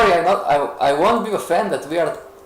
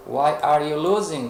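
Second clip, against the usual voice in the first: usual speaking voice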